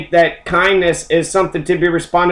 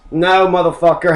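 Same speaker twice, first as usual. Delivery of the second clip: ordinary talk, nothing marked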